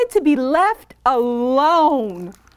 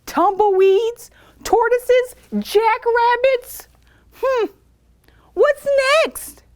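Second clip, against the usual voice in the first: grumpy; 8 semitones higher than usual. A narrator's reading. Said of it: "said Hank".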